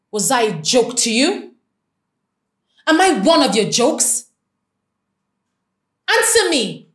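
loud furious demand; angry. Acted. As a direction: shout with hurt anger. Confronting engaged partner.

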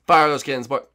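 normal talking voice

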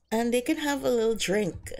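ordinary delivery

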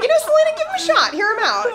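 squeaky, laughing pep talk to herself; high excited self-pep talk. Retold or imitated. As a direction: cheer yourself on, high and giddy. Coaching herself in quote.

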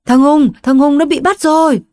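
mother telling husband, high panic; panicked mother. Acted. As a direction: blurt it out in shock.

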